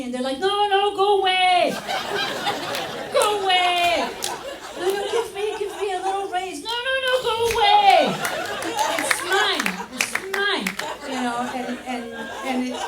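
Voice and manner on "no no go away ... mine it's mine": put-on high, loud childish whining. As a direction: shriek like a toddler guarding toys.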